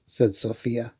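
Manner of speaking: ordinary delivery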